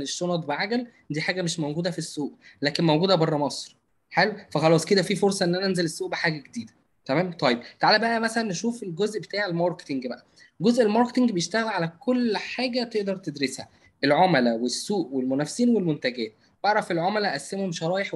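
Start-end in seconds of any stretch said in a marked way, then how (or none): none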